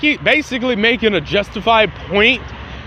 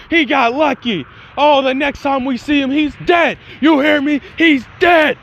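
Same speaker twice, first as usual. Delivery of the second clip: hammed-up hot-headed fury, punching the threat; angry threat; 7 semitones higher than usual. Hot-headed boy vowing revenge on his rival, angry. Bakugo vows revenge after boast.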